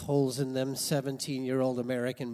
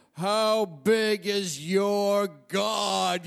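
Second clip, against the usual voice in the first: higher, very slow, drawn-out preacher's call; preacher's challenge; 7 semitones higher, 6 dB louder, much slower than usual. Retold or imitated. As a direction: stretch out every word as a booming challenge. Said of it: "and he'd go".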